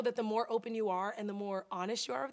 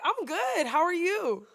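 higher, overdone "I'm good"; fake fine; 6 semitones higher, 5 dB louder, slower than usual. Nervous new client greets therapist, faking fine. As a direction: put on being fine, pitched up. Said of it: "Clearly not good, right?".

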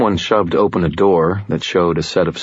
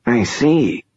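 wheezy nasal voice; 7 semitones higher than usual. Acted. Lawyer Glau speaks.

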